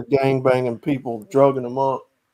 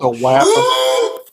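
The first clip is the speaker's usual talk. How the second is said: mock nickname; much slower than usual. Joking nickname.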